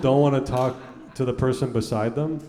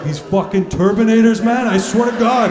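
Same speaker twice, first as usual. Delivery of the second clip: much higher and louder imitation of an enraged buddy; mock furious rant; 10 semitones higher, 9 dB louder than usual. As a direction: shout it like a fuming conspiracy guy. Friend furious about conspiracies.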